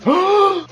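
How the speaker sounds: loud mock-shocked gasp; dramatic gasp. Confessing to never seeing Rambo, loud dramatic gasp. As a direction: gasp sharply in mock horror.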